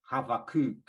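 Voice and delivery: Havakuk said ha-va-KUK with a V, the last syllable stressed; ha-va-KUK, V in the middle, end stressed; Hebrew pronunciation. Shown as a demonstration. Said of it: "the emphasis on the ... the last syllable".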